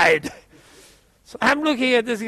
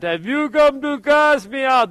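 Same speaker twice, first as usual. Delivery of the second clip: demon voice; 3 semitones higher, 3 dB louder than usual. Retold or imitated. Demon speaks through the uncle.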